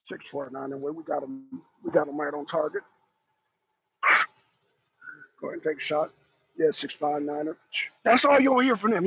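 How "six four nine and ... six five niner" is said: much lower, much softer clipped radio talk; calm commando radio. Acted. Commandos on the radio before the shot, low calm murmur. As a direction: murmur like a sniper on comms. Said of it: "the commandos are gonna be going is".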